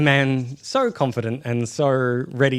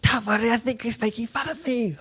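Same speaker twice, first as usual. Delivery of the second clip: much higher character voice; put-on character voice; 12 semitones higher than usual. Game master voicing a character who feels mocked, high put-on voice. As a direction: pitch it way up, in character.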